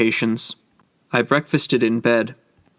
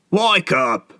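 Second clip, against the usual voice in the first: higher, sharp angry command; an annoyed ticket man waking a sleeper; angry call; 6 semitones higher than usual. A narrator's reading. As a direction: snap him awake, irritated. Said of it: "said an angry voice".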